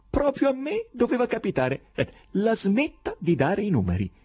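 high, odd foreign professor, amused and exasperated; eccentric professor voice. Acted. The professor mocks the poet, in a high strange voice.

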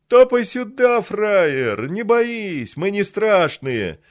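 high, loud taunting call; mocking thug shout. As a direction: jeer at him from the gate. Thug calls the stranger over.

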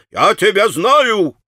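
irritated exclamation, stronger. A narrator's reading. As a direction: repeat it angrier, drawn out. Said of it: "восклицал он раздражённо".

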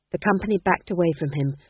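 plain reading voice

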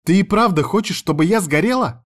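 high-pitched outraged question; indignant protest. A narrator's reading. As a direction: plead in high exasperation. Sister protests the beach plan.

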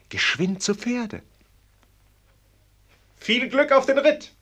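rousing farewell call. Acted. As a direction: cheer him off at full voice. Sends prince off riding.

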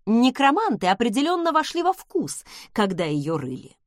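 ordinary delivery, nothing marked

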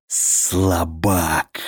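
low monster growl. A narrator's reading. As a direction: growl the insult like a beast. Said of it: "прорычал он".

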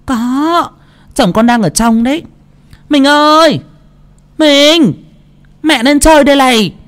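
high loud eager call; chattering wife. A narrator's reading. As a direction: gush and call the husband over. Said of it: "mồm vẫn liến thoáng".

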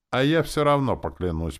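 high, loud, mildly menacing; threatening. A narrator's reading. Captain threatens to swear anyway, menacing. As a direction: insist on it as a threat. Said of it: "проговорил он угрожающе".

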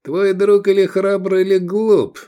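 high, loud menacing remark; angry challenge. A narrator's reading. Sheriff sizes up the defiant zealot, angrily loud. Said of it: "процедил Рахаль".

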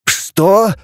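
a jolt of surprise; startled. A narrator's reading. Teacher flinches at bad news, startled. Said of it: "вздрогнул учитель".

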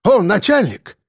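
high-pitched, eager hail; excited character call; exclaiming. A narrator's reading. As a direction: exclaim it on spotting an ally. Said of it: "воскликнул Акбар".

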